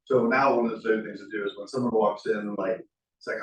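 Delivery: usual speaking voice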